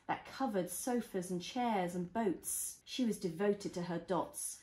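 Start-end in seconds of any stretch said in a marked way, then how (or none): none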